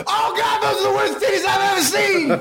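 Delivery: very high strained holler; appalled hype man. Retold or imitated. Emcee blurting verdict to the crowd, appalled. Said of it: "and he goes".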